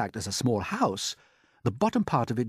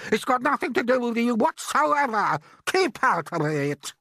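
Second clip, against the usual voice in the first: a much higher, louder put-on aunt's voice, excited; Aunt Sponge; 12 semitones higher, 6 dB louder than usual. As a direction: play the greedy aunt gaping at the peach. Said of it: "Aunt Sponge declared".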